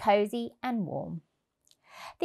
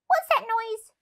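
much higher little mouse; squeaky mouse; 13 semitones higher, 3 dB louder than usual. Acted. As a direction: squeak the question. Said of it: "he squeaked".

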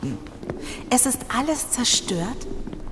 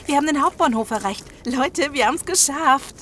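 higher and louder, bursting with relief; jubilant relief; 6 semitones higher, 4 dB louder than usual. Acted. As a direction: cheer to the others that you made it. Group finally reaches the station.

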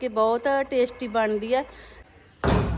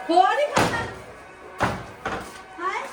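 calling out; 9 semitones higher, 4 dB quieter than usual. Real, not acted. Offering food to Sardar ji.

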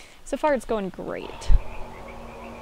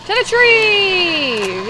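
loud, high, long-drawn battle cry; drawn-out charging yell; rallying cry; 7 semitones higher, 8 dB louder, much slower than usual. Real, not acted. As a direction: holler it and stretch the last word out. Calling out a charge in game.

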